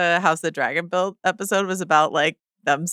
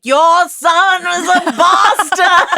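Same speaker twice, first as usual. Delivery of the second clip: full-voiced, drawn-out shout, much higher; booming accusation; 11 semitones higher, 7 dB louder, much slower than usual. Retelling Corliss's outburst to co-hosts, a big booming shout. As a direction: bellow it out as a public denunciation. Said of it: "but he was like".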